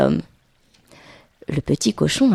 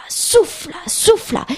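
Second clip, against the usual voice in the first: high, puffed storytelling verbs; acted-out huffing; 11 semitones higher, slower than usual. A narrator's reading. Narrator shows the wolf blowing at the bricks, emphatic. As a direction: huff the word out like a blow.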